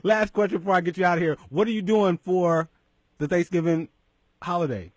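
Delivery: usual speaking voice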